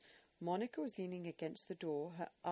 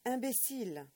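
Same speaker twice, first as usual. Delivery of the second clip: louder, higher, cutting French insult; harsher repeat; 6 semitones higher, 7 dB louder than usual. Acted. Old man shaking the boy, repeating imbécile harder. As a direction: drive it home harder. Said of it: "he pronounced between shakes".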